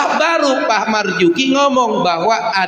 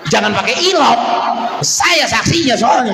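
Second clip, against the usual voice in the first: shouting; 4 semitones higher than usual. Real, not acted. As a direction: shout it high to the crowd. Preacher calling out to crowd. (teriak lantang ke jamaah)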